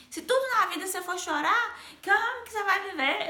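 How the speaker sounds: a mom fed up with the tears; angry mother. Retold or imitated. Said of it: "E minha mãe ficou muito brava".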